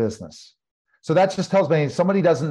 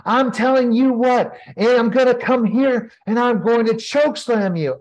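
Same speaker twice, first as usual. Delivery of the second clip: higher, louder mock babyface promo; hammed-up wrestler promo, raised and loud; wrestling promo shout; 9 semitones higher, 4 dB louder than usual. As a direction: belt out a pumped-up ring promo. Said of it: "I turned baby face".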